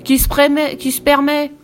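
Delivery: loud, high-pitched elephant protest; affronted storybook shout; outraged exclamation. A narrator's reading. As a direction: trumpet the question indignantly. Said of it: "s'exclama Trompette de sa grosse voix profonde".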